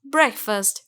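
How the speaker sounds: ब्रेकफास्ट said "BREK-fust"; correct pronunciation. Shown as a demonstration. Teacher sums up the right word, clearly pronounced. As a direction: say ब्रेकफास्ट with short e and weak "fust". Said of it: "तो word सही है".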